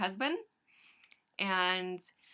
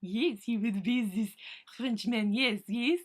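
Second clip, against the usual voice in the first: talking to the cat; 4 semitones higher, 6 dB louder than usual. Acted.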